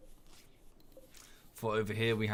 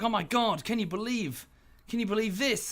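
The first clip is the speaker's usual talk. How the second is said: mock outrage; 10 semitones higher than usual. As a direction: ham up an excited online American. American Redditor outraged by news.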